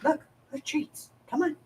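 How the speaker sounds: high, sweet coaxing voice; coaxing a pet. Real, not acted. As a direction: lure the cat down with treats. Coaxing cat off her lap.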